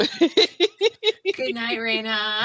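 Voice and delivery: laughing. Real, not acted. Laughing goodnight to player.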